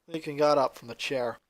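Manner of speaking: ordinary delivery, nothing marked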